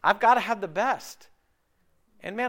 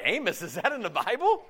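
mock puzzled listener; 3 semitones higher than usual. Preacher mocking a congregant who doesn't know Amos, laughing. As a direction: tease a clueless churchgoer. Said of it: "You're like".

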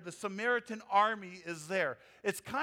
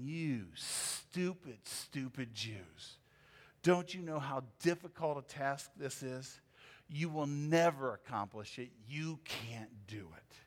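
sneering enemies; 7 semitones lower, 4 dB quieter than usual. Retold or imitated. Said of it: "It was as if they were saying".

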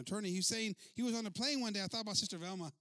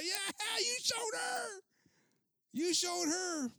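high, drawn-out imitated cheer; crowd yelling; 13 semitones higher, 6 dB louder, much slower than usual. Preacher imitating passengers cheering the man on, high shouting. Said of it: "gente comenzó a gritar".

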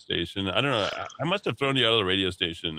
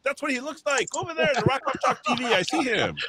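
high excited. Real, not acted. Excited pointing out on TV.

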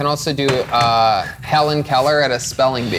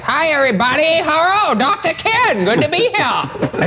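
imitating Doctor Ken. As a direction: ham up his accent way up. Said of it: "he came right into the room and he's like".

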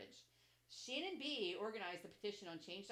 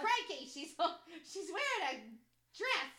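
loud, high, hammed-up Australian, laughing; bad Australian accent; 9 semitones higher, 11 dB louder than usual. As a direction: attempt a big Australian accent and fumble it.

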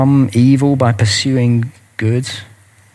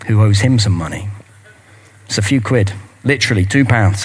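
ordinary talk

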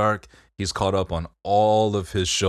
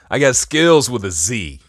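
mock brag; 5 semitones higher, 8 dB louder than usual.

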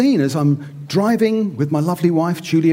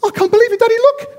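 put-on much higher, louder child voice, amazed; awed little boy; 11 semitones higher, 5 dB louder than usual. Boy on promenade calling to his dad, amazed high child voice. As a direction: squeal like a wide-eyed boy. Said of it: "I imagine the boy says".

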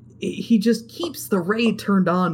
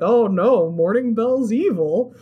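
higher, drawn-out mock shock; mock dismay; 6 semitones higher, 4 dB louder, slower than usual. Voicing everybody realizing the truth, mock dismay. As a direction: wail it like a pretend crowd reaction.

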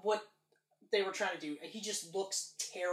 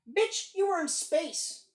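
higher, drawn-out mock outburst; lightly voiced, stretched-out movie quote; imitating Nick Fury; 9 semitones higher, 5 dB louder, slower than usual. Podcaster mocking a Nick Fury line, imitated higher and drawn out. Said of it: "when Nick Fury goes".

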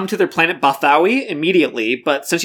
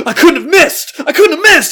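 high, loud freak-out; panicked Dash; 11 semitones higher, 7 dB louder than usual. Acted. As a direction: shriek it in disbelief. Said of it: "He's freaking out about it".

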